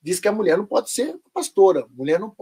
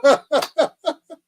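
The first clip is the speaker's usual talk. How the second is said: high loud burst of disbelieving laughter; a loud high laugh at the absurdity; incredulous laugh; 10 semitones higher, 5 dB louder than usual. Real, not acted.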